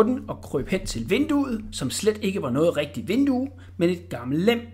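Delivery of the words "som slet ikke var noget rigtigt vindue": usual speaking voice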